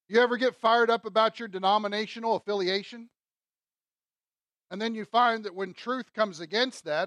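normal talking voice

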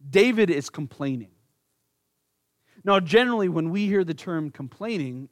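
usual speaking voice

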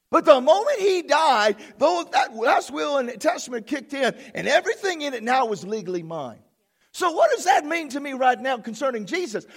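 loud, high, emphatic sermon building to a shout; fired-up pulpit proclamation; full-force preaching. Real, not acted. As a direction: preach it up to a triumphant cry.

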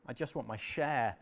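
normal talking voice

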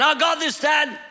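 shouting to him to swim back; urgent yell across the water; father calling his son back from the shore. Retold or imitated. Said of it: "I heard the words again".

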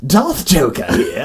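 lightly voiced comic villain; a hint of cartoon supervillain; Joker. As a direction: introduce yourself in a playful villain voice. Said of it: "starts talking like the Joker".

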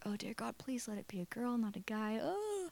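hushed, anxious mini-prayer; pleading whisper. Retold or imitated. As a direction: beg under your breath. Retells her silent plea to God.

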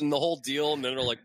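ordinary talk, nothing marked

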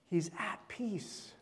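soft whisper of calm. Acted. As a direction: breathe it out as a secret.

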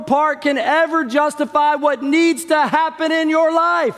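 high, shouted full-force preaching; worked-up preaching. Real, not acted. Preacher to his congregation about the Spirit, shouting fervently. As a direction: fire it at the congregation at the top of the voice. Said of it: "I got a little worked up".

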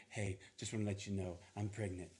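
soft and low; hushed confession. Retold or imitated. Mary quietly telling Joseph she's pregnant, hushed. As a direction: drop it gently and quietly.

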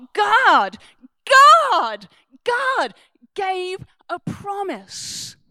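impassioned preaching. Real, not acted. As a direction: hammer the name, then slow down. Preacher builds to climax.

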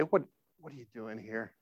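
very soft, low gentle question; hushed, caring voice; tender God. Retold or imitated. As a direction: ask it quietly, with great kindness. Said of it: "there's a tenderness in God's voice".

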